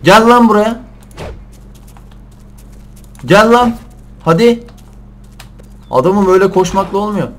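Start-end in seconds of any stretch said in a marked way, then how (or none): 0.0-4.7 loud high call to an animal; loud, coaxing high yell. Real, not acted. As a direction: shout to lure it closer. Calling a game animal over.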